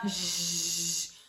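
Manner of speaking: "J" as a long buzzing zh sound; letter sound. Shown as a demonstration. Mother showing her child the J sound, drawn out. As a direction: hold the "J" hiss out low and long. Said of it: "quais são as letras que eu recomendo".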